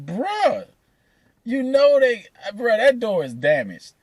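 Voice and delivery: high, disbelieving bruh; high incredulous bruh, that door is damaged; disbelief. Retold or imitated. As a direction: tell him the door is wrecked in disbelief.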